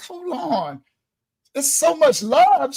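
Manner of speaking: stunned troll. Retold or imitated. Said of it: "June was like".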